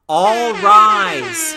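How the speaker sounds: loud, high boom over speakers; booming announcement. A narrator's reading. Announcer over the sound system, booming at the crowd. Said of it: "a voice boomed from the sound system".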